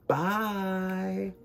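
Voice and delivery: plain reading voice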